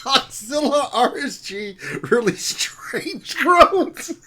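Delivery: slow, loud, laughing through the words; laughing shout. Real, not acted. Mangling the guild name, laughing shout. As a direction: force the words out between laughs.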